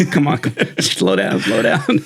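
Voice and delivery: retold plea broken by laughter; laughing through quote. Cramming candidate to the guys beside him, laughing.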